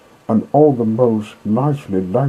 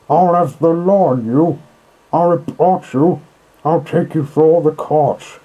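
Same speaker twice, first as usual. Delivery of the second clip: furious shouting; 9 semitones higher, 7 dB louder than usual. Acted. Rat dances in the road, shouting threats at the motorists. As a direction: hurl every insult at the road hogs. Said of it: "he shouted, shaking both fists".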